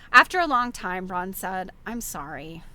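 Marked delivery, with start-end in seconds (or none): 1.9-2.6 lower, softer apology; subdued apology. A narrator's reading. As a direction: apologise quietly after a quarrel. Braun apologises after a long silence.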